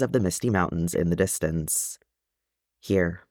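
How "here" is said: usual speaking voice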